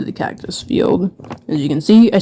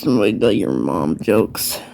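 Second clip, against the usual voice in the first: deep mock voice; 8 semitones lower, 3 dB quieter than usual. Mock announcer comment on roast.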